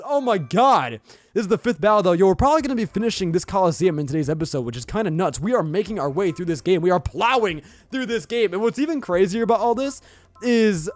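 normal talking voice

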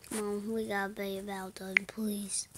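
hushed whisper, begging; pleading whisper. Real, not acted. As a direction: whisper a plea under your breath. Begging the enchant to finish.

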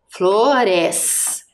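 FLORES very slow, split into two long syllables. Shown as a demonstration. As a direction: sound out FLORES slowly, stretching each part. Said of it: "Ures é a segunda sílaba". (flores bem devagar, separando as sílabas)

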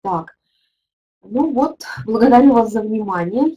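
usual speaking voice

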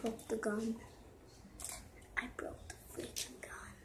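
hushed frustrated whisper. Real, not acted. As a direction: whisper it in disbelief.

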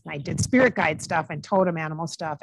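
ordinary talk

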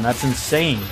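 ordinary delivery

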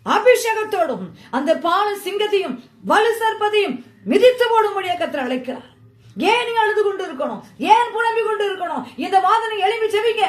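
high, loud, driving exhortation; impassioned revival-style cry; full-force preaching. Real, not acted. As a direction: preach it at the top of your range, urging the congregation up.

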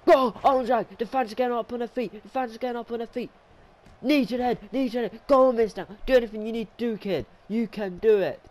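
high, loud urging; frantic excitement. Real, not acted. Commentator urging the underdog on as fans stand, high and excited.

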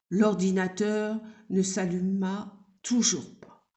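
usual speaking voice

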